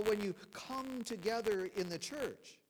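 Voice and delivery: "come" stretched out and set apart; slow down and lean on "come together"; stress on "come together". Shown as a demonstration. Preacher reading Paul's rebuke aloud, stressing "come together". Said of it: "I'll emphasize the word".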